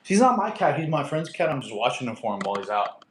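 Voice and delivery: normal talking voice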